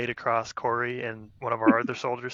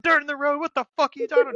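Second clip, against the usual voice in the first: much higher and louder, outraged disbelief; retold furious shout; 7 dB louder, faster than usual. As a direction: explode at the excuse in a high yell. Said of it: "Just goes off on this kid".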